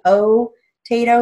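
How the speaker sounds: "potato" with a long O sound; long O pronunciation. Shown as a demonstration. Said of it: "you would write it with a long O".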